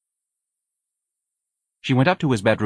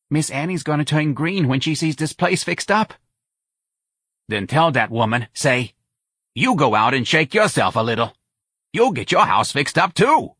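crowing friend, pitched up; Santa, gloating; 6 semitones higher than usual. A narrator's reading. Friend crowing about Miss Annie's envy, gloating. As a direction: gloat over the envious neighbor.

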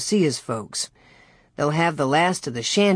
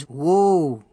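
calling to horses. A narrator's reading. Pa calls the horses to stop.

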